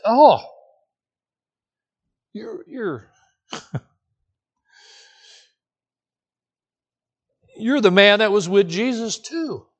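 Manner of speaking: high, sudden blurt of recognition. Retold or imitated. Servant girl to Peter by the fire, a lightly acted excited blurt. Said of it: "she blurts out what she says".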